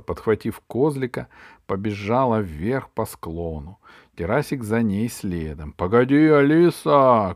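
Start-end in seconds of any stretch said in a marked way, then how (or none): 5.7-7.3 loud heroic call, stretched at the end; shouting. A narrator's reading. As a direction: call after the girl across a distance. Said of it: "крикнул богатырь". (громкий оклик богатыря)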